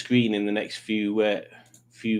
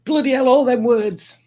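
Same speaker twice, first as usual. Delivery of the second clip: exasperated outburst; 12 semitones higher, 5 dB louder than usual. Real, not acted.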